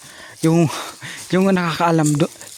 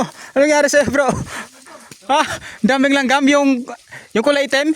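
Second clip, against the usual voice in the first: high, louder startled questions; alarmed concern; 10 semitones higher, 3 dB louder than usual. Real, not acted. Hiker asking a companion what happened, alarmed. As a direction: ask in a raised, urgent pitch.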